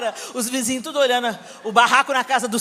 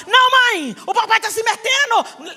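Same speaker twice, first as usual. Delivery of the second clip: much higher, louder indignant complaint; reclamando com a mãe; 9 semitones higher, 4 dB louder than usual. Retold or imitated. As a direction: whine it out, outraged. (filha gritando indignada com a mãe)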